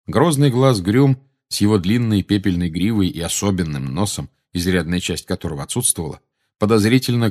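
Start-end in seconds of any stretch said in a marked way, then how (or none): none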